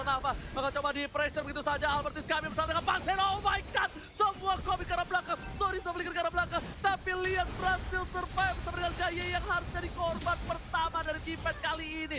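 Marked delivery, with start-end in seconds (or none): 1.9-12.2 raised pitch, hyped caster call; excited play-by-play. Acted. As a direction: call the finish with rising energy. Game-ending play-by-play call.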